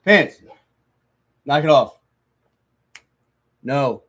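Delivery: sharp loud command; scolding the dog. Real, not acted. Scolding his dog mid-recording, a loud sharp command. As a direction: snap at the dog to stop.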